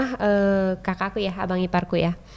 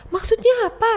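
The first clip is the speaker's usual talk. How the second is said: high, loud, confused question; a woken mum asking what's going on; mother jolted awake, asking what she means; startled mother; 14 semitones higher, 4 dB louder than usual. Retold or imitated.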